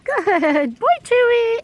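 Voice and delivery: very high praise voice; dog praise. Real, not acted. Dog sitter praising Charcoal, very high.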